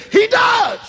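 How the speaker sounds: does hit high and loud; emphatic. Real, not acted. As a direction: slam the word does. Preacher's emphatic retort.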